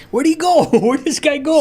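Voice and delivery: where did he vanish, half laughing; baffled surprise. Retold or imitated. Finding the driver gone, high laughing surprise. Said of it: "So we're like".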